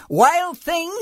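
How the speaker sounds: high, loud scolding call; mother calling out. A narrator's reading. Mother to Max in his wolf suit, high scolding shout. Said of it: "his mother called him".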